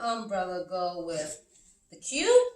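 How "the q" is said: normal talking voice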